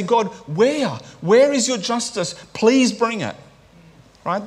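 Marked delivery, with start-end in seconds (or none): 0.0-3.4 high, urgent cry to God; dependent plea. Acted. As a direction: call out to God, pitched high and pleading. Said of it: "a call of dependency on God".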